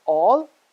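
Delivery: alternative split as al, ter, nay, tive with pauses; syllable by syllable. Shown as a demonstration. Teacher breaking down the word, alternative in four separated syllables. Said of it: "has four syllables".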